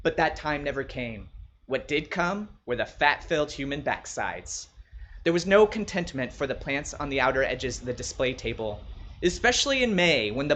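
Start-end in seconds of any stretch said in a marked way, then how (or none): none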